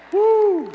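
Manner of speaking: a very high, loud cheer of joy; excited whoop. Real, not acted. Preacher celebrating praying mothers, a high loud whoop. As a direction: let out a joyous woo. Praising God for praying mamas.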